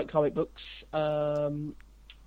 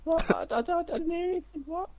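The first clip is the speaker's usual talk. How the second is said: high, nervous squeaky voice; timid background guy; 13 semitones higher than usual. Retold or imitated. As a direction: play dumb, pretend you heard nothing. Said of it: "McGee's in the background going".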